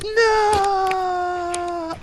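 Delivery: long, high, loud cry of "no"; high-pitched hammed-up scream; anguished yell. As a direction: yell it out in drawn-out dramatic despair. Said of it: "and he yells".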